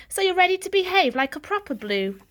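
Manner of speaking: a lightly voiced stern father; fatherly approval, lightly voiced. Father voiced in read story.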